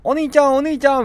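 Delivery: clingy little sister. Pretend. Imaginary little sister in his throat begging, clingy and high. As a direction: whine like a little sister wanting attention. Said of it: "すごい甘えてくる感じ".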